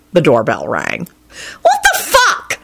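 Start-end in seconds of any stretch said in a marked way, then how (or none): 1.6-2.6 very high-pitched outraged shriek; angry shriek. A narrator's reading. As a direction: shriek it in exasperation. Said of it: "she shrieked and flung the book".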